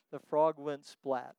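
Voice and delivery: normal talking voice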